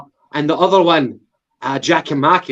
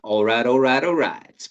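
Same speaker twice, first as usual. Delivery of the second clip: Matthew McConaughey; 3 semitones lower, 4 dB quieter than usual. Acted. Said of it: "Matthew McConaughey".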